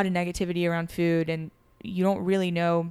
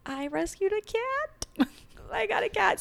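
excited; slower than usual. Real, not acted. Proud cat announcement after a gasp.

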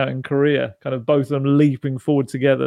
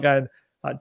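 ordinary delivery

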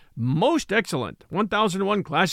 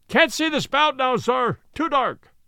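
higher lookout calling down from the mast; called from aloft; 7 semitones higher, slower than usual. Acted. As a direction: call down from the masthead. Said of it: "cried a voice from the air".

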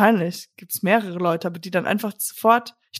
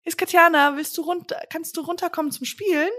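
put-on child voice; 8 semitones higher than usual. Playing kid ringing friend's doorbell.

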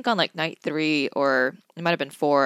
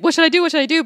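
high, loud mock panic; frantic; 12 semitones higher, 8 dB louder, much faster than usual. Imagined stranded friend asking for help, frantic. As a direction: imitate a friend frantically begging for direction. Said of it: "very frantic and asking".

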